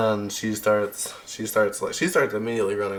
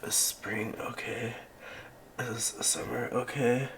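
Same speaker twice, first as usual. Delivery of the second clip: soft, weak worried question; quiet, battered and concerned; hurt survivor; 2 semitones higher, 10 dB quieter than usual. Acted. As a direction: ask faintly, barely holding up. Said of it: "and she says".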